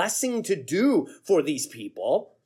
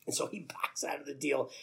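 whispered aside; 4 semitones lower, 10 dB quieter than usual. A narrator's reading. Narrator drops to an aside.

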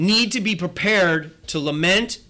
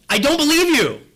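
louder and higher, still incredulous; higher mock yell; 8 semitones higher, 5 dB louder than usual. Preacher repeating his retort at the commercial, higher mock yell. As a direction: push the disbelief further up. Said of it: "I almost feel like yelling back at the T V".

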